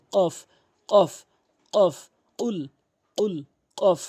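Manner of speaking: কফ, উল popped hard from the tongue root; pushed back-of-tongue sound. Shown as a demonstration. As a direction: punch out each কফ and উল against the back palate. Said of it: "তখন এভাবে উচ্চারণ হবে". (কফ, উল জিহ্বার গোড়া থেকে ধাক্কা দিয়ে)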